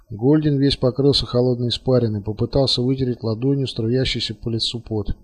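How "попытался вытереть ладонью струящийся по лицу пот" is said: plain reading voice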